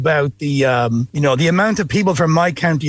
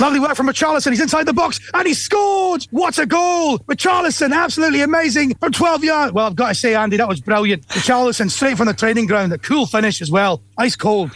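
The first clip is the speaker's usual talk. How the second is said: football commentary; 5 semitones higher than usual. Shown as a demonstration. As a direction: call the goal like a live commentator. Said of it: "the commentator in English and the Scottish co-commentator".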